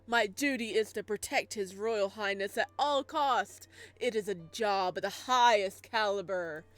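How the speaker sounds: chin-tucked pompous voice. Acted. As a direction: announce it with pompous pride. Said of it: "loud, pompous voice".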